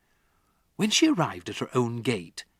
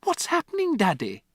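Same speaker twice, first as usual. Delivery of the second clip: put-on high, pleading child's question; a daughter begging for answers; alarmed girl; 13 semitones higher, 4 dB louder than usual. Matilda to her father, high anxious plea.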